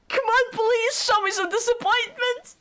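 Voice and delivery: high-pitched desperate begging; pleading. Acted. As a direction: beg him in a high, pleading voice. Pleading with game character.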